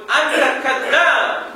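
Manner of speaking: loud, slow, high Arabic accusation; accusing shout. Retold or imitated. Ustaz acting someone shouting "you liar" in Arabic. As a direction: hurl the accusation. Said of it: "Lalu dipukul".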